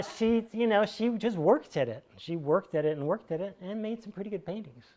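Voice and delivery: ordinary delivery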